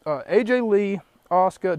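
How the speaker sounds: ordinary talk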